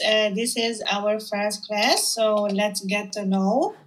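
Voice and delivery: ordinary talk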